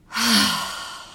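long audible exhale sigh; big sigh out. Shown as a demonstration. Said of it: "a big sigh out".

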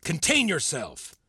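high-pitched, exasperated reprimand; indignant scolding. Acted. Mentor berating student for arguing back, indignant scolding. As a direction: build from stern rebuke to worked-up tirade.

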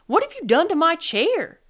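high, loud, indignant question; upset demand. A narrator's reading. Sloth to Armadillo about his chair, upset demand. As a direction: demand an explanation loudly.

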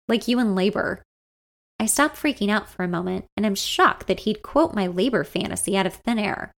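usual speaking voice

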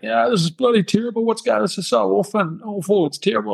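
high-pitched mock wail of despair; imitated sobbing. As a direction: imitate yourself blubbing down the phone, voice pitched right up. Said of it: "I was kinda sobbing and a little bit emotional".

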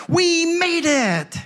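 high, drawn-out cry, every word stretched; mock excited shout. Woman leaping from car to pastor, mock excited shout. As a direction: imitate someone calling from afar in a high voice. Late arrival calls across parking lot.

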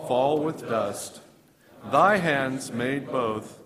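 loud, slow congregational reading; unison prayer. Real, not acted. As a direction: read it together as one prayer, slowly and loud. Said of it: "we will read it like it's a pr-, our prayer to God".

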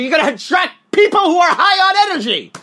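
high, loud hype yell; explosive motivational shout; high-energy shout. Shown as a demonstration. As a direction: burst into it to show huge energy. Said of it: "If you're high on energy".

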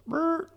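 very high, loud imitated deer blow; a squeaky imitated snort. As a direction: mimic the buck's sharp snort. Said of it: "And he goes".

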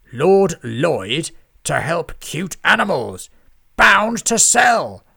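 loud, slow booming self-praise; pompous scheming lord. A narrator's reading. Lord Lloyd lays out his plan to the scribes, loud and gloating.

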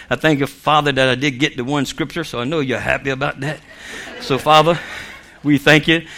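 low, soft closing prayer; leading prayer. Real, not acted. Preacher thanking Father to close the message, subdued prayer. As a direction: pray aloud in a subdued, settled voice.

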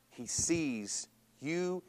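slow and soft, lingering on each word; tender reassurance. Acted. Preacher softly assuring his listeners they are seen. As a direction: let it land gently, like a promise.

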